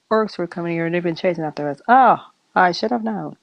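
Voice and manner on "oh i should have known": usual speaking voice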